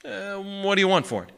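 offhand question with lifted pitch; casual. A narrator's reading. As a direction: ask as if it hardly matters. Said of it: "he said casually".

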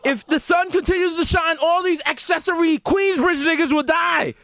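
high, loud mock activist; mock Greta. Mock Greta, loud and high. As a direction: ham up a preachy teen. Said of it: "She's like".

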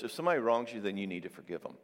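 ordinary delivery

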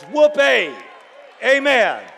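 high, full-force jubilant shout; shouting back to God. Acted. Believer answering God's promises while fighting illness, shouting loudly. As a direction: belt out the praise. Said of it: "That's what we shout back to God".

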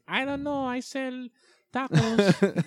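high, innocent shrug voice; clueless bystander voice. Retold or imitated. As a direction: squeak it out like you want no trouble. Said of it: "Spanish people just standing in the middle like".

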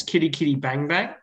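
normal talking voice

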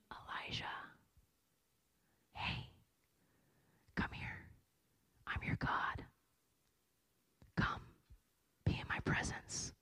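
tender unvoiced whisper; God whispering lovingly to Elijah; God's whisper. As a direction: call him close in a gentle whisper. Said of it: "He's in the whisper".

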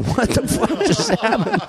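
high, slow, incredulous squeal; stunned disbelief. Retold or imitated. Retelling his shock at bloody accident.

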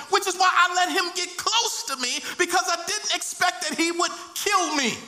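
high-pitched cry of betrayal; anguished protest. Acted. Slain brother telling God of betrayal, anguished protest.

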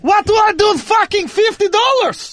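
Russian dad. Pretend. Russian dad character continues.

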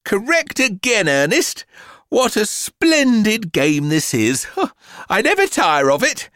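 high-pitched mother feigning delight; overdone, high enthusiasm that is a lie; false enthusiasm. A narrator's reading. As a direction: gush with put-on cheer and a little laugh. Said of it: "she lied".

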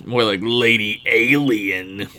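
slow, drawled knowing jab; corny sinister old-timer voice; bad Twain impression. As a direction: drag it out like a sly old man. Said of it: "that was fucking terrible".

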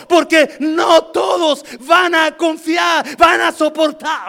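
high, slow, drawn-out shout; shouted sermon with long held words; full-force preaching. Real, not acted. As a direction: thunder it out to the whole congregation.

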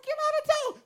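very high, squealing get out of town; mock shock. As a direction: squeal it in over-the-top disbelief. Shocked someone likes him.

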